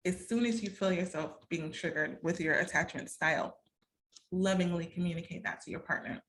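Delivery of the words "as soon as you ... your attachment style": ordinary talk, nothing marked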